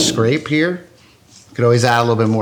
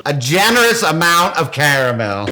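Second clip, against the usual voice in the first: higher, mildly yelled repeat; screaming; 5 semitones higher, 3 dB louder than usual. Real, not acted. Cook told to stop screaming, defiantly yelling it. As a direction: keep calling it out in defiance. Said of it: "Stop screaming".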